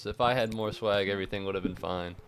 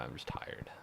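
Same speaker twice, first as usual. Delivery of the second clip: whispered, worn-out admission; tired whisper; 7 semitones lower, 13 dB quieter than usual. Real, not acted. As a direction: let it slip out in a tired whisper. Tired streamer admits exhaustion.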